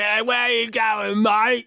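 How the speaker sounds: high, slow, hammed-up Australian accent; badly faked Aussie lout calling someone out; Australian scumbag. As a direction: do a terrible high-pitched Australian. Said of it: "her date's an Australian scumbag".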